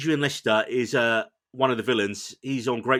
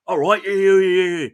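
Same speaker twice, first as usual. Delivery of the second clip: mock Essex accent; 6 semitones higher, 7 dB louder than usual. Imitating the actress's Essex accent to the co-hosts. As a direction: ham up a cockney Essex "yeah, yeah". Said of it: "sounds like a female Danny Dyer".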